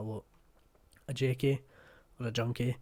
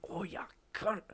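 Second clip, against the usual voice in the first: high, strained yelp held under the breath; acted pained cursing; 8 semitones higher, 4 dB quieter than usual. Showing what you say after banging a toe, acted pained curse. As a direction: curse as if you just banged your knee. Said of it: "if you've hurt yourself".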